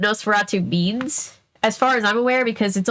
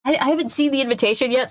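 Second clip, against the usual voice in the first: ordinary delivery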